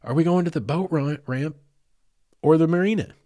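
calling out a question over the noise; retold holler. Man hollers from the back to ask where to land. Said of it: "Jay Bake's hollering at me".

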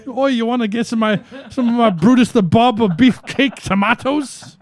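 lampoon the tomato seller; mock British seller. Teasing friend by mimicking the British seller. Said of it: "It's not beefcake".

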